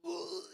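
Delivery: high, disgusted ugh. Retold or imitated. Guest finding hair in the pasta, disgusted high ugh. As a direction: recoil at the hair with a grossed-out ugh. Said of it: "And I'm like".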